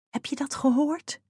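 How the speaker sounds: whisper. A narrator's reading. Ottilie whispers to Fiep after sneaking back. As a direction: whisper it urgently as a question. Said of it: "fluisterde ze".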